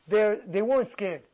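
ordinary talk, nothing marked

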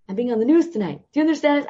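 high and loud, bursting with joy; thrilled. Retold or imitated. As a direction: shout the news to mom in delight. Telling mom about the news job.

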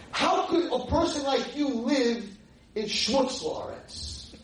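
high, loud shrieked scolding; screaming. Retold or imitated. Former student berating rabbi for not living in Israel, screaming. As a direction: imitate an outraged young woman screaming each question. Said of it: "And she was screaming".

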